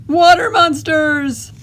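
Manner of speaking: high, loud, drawn-out panic; alarmed cry. A narrator's reading. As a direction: shout in theatrical fright. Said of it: "And one cried".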